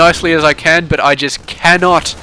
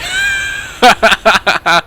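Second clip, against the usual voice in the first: big laugh breaking out; 4 semitones higher than usual. Real, not acted. Laughs at a funny comment.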